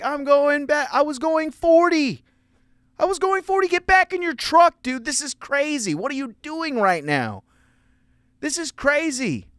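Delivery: high, loud, exasperated yelling back. Retold or imitated. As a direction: shout him back to his truck. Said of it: "I was like".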